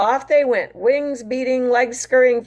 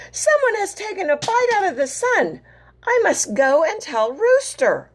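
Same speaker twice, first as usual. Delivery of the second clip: high anxious hen voice; worried and urgent; alarmed hen; 9 semitones higher than usual. Acted. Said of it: "cried Hen".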